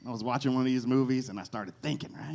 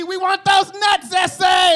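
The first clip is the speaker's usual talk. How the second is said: high, loud, slow shouted chant; screaming squirrels; 13 dB louder, slower than usual. Retold or imitated. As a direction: yell like a jail gang of squirrels. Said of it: "They just kept screaming at me".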